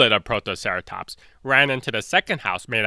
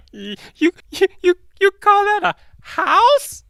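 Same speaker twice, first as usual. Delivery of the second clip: very high, loud cartoon taunt; mocking T-Rex roar; 5 dB louder than usual. T-Rex jeers at the leaf house, high loud taunt. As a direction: sneer at the flimsy house like a silly monster. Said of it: "the T-Rex roared".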